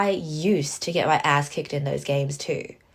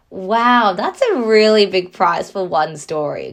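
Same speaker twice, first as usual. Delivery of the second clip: much louder sarcastic disbelief; loud mock outrage; 8 dB louder than usual. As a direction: scoff at the prize, loud.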